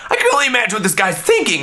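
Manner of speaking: ordinary delivery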